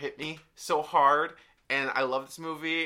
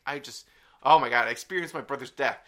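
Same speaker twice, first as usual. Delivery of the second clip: ordinary talk, nothing marked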